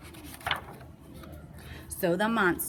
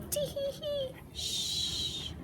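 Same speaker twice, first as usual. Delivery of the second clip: sneaky giggle; 6 dB quieter, much slower than usual. Acted. Hiding kids giggling.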